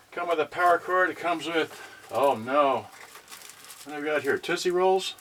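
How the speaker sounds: ordinary talk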